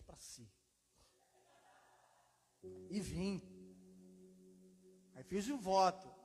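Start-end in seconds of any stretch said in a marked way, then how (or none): 0.0-0.5 Portugal Portuguese. Retold or imitated. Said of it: "falou que nem português".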